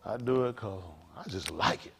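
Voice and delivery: ordinary talk, nothing marked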